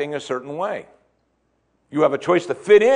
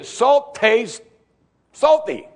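mock obvious answer; 9 semitones higher, 4 dB louder, much slower than usual. Shown as a demonstration. Preacher voicing the obvious answer.

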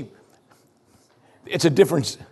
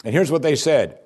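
normal talking voice

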